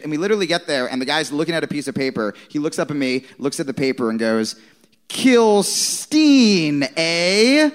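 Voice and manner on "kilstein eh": louder radio-host voice, drawn out; mock radio host. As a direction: ham up the shock jock sizing him up. Shock jock reads his name.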